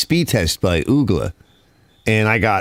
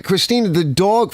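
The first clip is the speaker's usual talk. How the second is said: higher-pitched mock yell at no extra volume; retold yelling; 8 semitones higher than usual. Father arguing with daughter about the dog, mock yelling. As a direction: re-enact snapping back in an argument. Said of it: "Then I start yelling".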